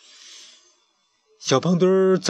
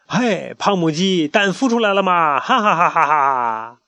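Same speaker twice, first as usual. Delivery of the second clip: mocking taunt; 6 semitones higher, 4 dB louder than usual. Loudmouth mocking the chick, jeering laugh. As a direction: taunt him and laugh in his face. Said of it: "讨厌的大嗓门在一旁嘲笑他".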